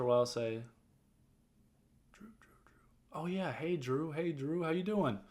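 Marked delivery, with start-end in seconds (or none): 2.1-2.7 high whisper, searching memory for the name; whispered recalling. Retold or imitated. Manager trying to remember the caller, whispering. 3.1-5.2 upbeat recognition. Retold or imitated. Manager recalls, greets caller.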